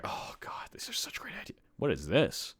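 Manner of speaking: awed, whispered disbelief; hushed food-lust whisper; overwhelmed with craving. Real, not acted. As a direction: whisper it as if too hungry to speak.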